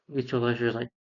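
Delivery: ordinary delivery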